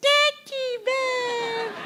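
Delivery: very high, stretched-out falsetto coo; mother's baby talk. Acted. Mother distracts the crying child again, high baby talk. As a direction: dangle the toy in a squeaky sing-song.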